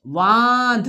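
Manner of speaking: बांध très étiré, son par son; étire बांध lentement pour faire lire la classe; lecture syllabée. Shown as a demonstration. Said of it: "हमारे साथ पढ़ना है आपको".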